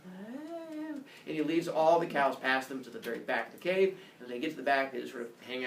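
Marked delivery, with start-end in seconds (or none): 0.0-1.0 high, hushed moo; soft cow moo. Acted. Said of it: "She's like".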